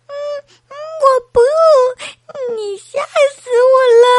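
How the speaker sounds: put-on high, loud baby wail; acted bawling of a scared baby; frightened crying. A narrator's reading. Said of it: "宝宝吓哭了".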